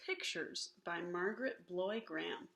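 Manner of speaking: usual speaking voice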